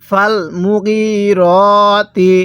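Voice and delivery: মুগিরতি drawn out on long "ee" and "o" vowels; slow Quran recitation. Shown as a demonstration. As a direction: chant it very slowly, holding each long vowel. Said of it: "আমার সাথে পড়ুন".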